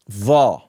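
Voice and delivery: va said low and heavy; Arabic va. Shown as a demonstration. Teacher introducing the letter va. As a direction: drop low for va. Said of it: "we have va".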